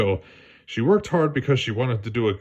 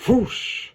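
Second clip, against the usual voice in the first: whoosh sound; 11 semitones higher than usual. Acted. Sound of sign.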